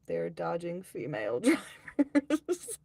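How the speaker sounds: real laugh bursting into the words; breaking into laughter. Real, not acted. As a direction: start slowly, lose it on the last word. Cracks up reading a chat message.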